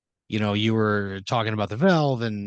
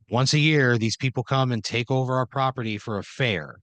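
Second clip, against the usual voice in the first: ordinary delivery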